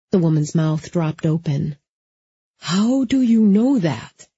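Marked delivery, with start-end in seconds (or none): 2.6-4.3 shocked. A narrator's reading. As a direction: gasp it out in disbelief. Woman shocked, mouth dropped open.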